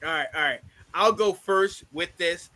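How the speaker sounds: ordinary talk, nothing marked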